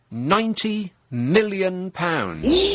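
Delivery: drawn-out build to pounds; milk the pause before the big number; dramatic reveal. Shown as a demonstration. Comedian teasing the audience with the figure, slow and dramatic. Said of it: "are you ready?".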